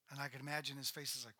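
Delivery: normal talking voice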